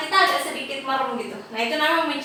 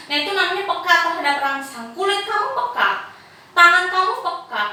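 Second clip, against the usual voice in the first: ordinary talk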